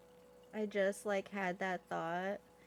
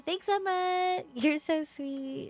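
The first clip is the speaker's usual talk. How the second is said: higher, slow, cutesy thanks; sweet thanks; 8 semitones higher, 4 dB louder, slower than usual. Real, not acted. Streamer coos thanks to a viewer named Emma. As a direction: coo your thanks to the viewer.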